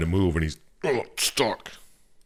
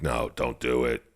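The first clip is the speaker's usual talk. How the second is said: lower, softer pleading demon; low demon warning; 7 semitones lower, 5 dB quieter than usual. Retold or imitated. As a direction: coax him away quietly and low. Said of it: "the darkness is like".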